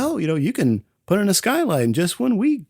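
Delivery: lightly voiced TV handyman; Bob Vila pitch. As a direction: sell it like a home-improvement host. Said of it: "he's like".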